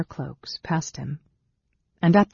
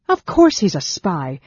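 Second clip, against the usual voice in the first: loud, raised retort; angry snarl; 4 semitones higher, 7 dB louder than usual. A narrator's reading. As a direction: snarl back at a fool. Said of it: "Sarah snarled".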